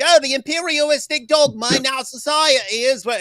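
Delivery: high, loud mock British accent; Russell Brand. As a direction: send up a silly-sounding British accent at high pitch. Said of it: "whatever Russell Brand is".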